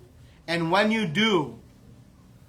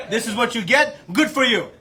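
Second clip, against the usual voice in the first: raised, higher scolding voice; I-told-you-so scolding; 5 semitones higher, 6 dB louder than usual. Acted. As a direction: tell off a little brother smugly. Balram scolds tied-up Krishna.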